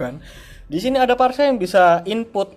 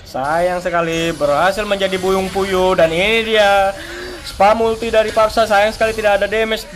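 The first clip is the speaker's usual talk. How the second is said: live play-by-play; 8 dB louder than usual. Real, not acted. Teamfight play-by-play.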